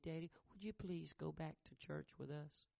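put-on a small boy's soft plea; soft child plea. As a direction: beg Daddy quietly. Little boy pleads with father.

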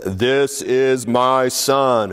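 slow, solemn first proclamation; unhurried, lightly voiced divine words; voice of God. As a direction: announce it gravely from the cloud. First telling of the voice.

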